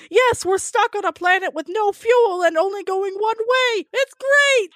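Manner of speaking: high, exaggeratedly upbeat quote; Murray. Quoting the line that got a laugh, mock-cheerful and high. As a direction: pitch climbing to a cheery finish. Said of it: "because of Murray saying".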